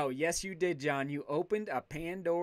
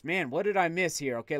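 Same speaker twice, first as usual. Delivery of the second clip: normal talking voice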